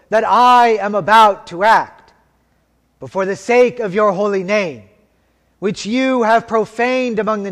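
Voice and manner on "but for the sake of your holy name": usual speaking voice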